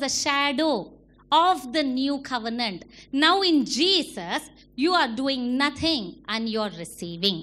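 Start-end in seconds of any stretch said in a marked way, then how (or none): none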